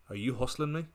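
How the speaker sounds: soft, understated accusation; quietly serious question. Acted. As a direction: stop dead and ask it softly. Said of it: "a more serious time".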